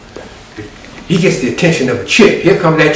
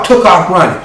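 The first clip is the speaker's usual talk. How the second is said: ordinary talk, nothing marked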